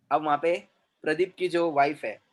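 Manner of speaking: ordinary talk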